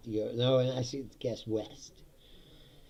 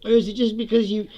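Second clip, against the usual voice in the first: mocking question; 10 semitones higher, 7 dB louder than usual.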